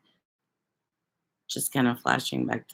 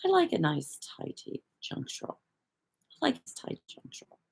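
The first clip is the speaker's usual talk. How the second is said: hushed, badly faked British accent; amateur British impression, trailing into a whisper; mock British accent; 7 semitones higher, 7 dB quieter than usual. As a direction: softly imitate a British man, poorly. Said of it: "British accent because".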